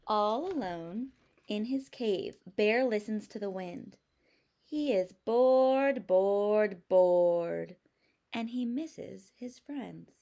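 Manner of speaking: slow, expressive storybook reading; reading to children. A narrator's reading. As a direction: read it like a picture book for second graders. Teacher reading story aloud.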